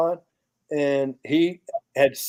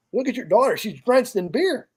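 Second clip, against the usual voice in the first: raised pitch, emphatic protest; retold insistence; 7 semitones higher than usual. Man insists to the mother about her soaked daughter, emphatic retelling.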